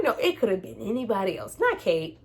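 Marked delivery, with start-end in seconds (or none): none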